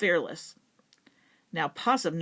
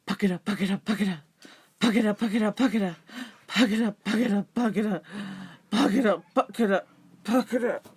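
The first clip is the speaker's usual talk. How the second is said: running chant; faster than usual. Acted. Possum running back with coal.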